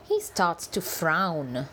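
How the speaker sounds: usual speaking voice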